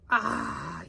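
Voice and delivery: ordinary delivery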